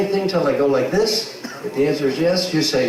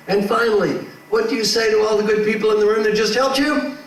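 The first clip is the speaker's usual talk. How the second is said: ordinary talk, nothing marked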